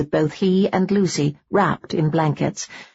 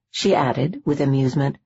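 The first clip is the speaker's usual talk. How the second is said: ordinary delivery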